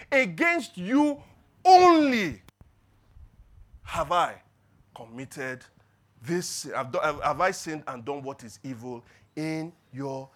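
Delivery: slow, loud, every word hammered; emphatic quote. Retold or imitated. Pastor quoting David's psalm, slow and emphatic. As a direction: lean hard on Against you only. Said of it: "he's even more emphatic".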